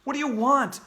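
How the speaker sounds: high, loud frightened question; quivering voice. Acted. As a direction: ask with a trembling voice. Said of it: "my voice quivering".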